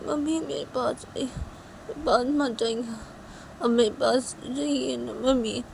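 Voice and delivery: put-on high, small child voice; pleading little child. Piu's daughter asking mummy not to leave, pleading.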